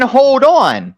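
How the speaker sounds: high, sneering parroting of hold on; a mock imitation of the other caller; mocking quote. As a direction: throw his words back at him, mocking. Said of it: "you're saying".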